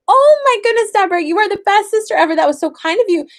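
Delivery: high, loud, fast over-the-top praise; gushing praise. Retold or imitated. Mother over-complimenting her daughter for sharing grapes, high and fast. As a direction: heap praise on her like a thrilled mom.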